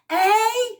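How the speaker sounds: high, loud puzzled eh; a deaf old woman who didn't catch it; deaf old lady's eh. Retold or imitated.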